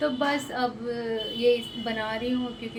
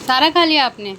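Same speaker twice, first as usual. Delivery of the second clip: delighted praise; 5 semitones higher, 12 dB louder than usual. Real, not acted. Mother praising child's eating.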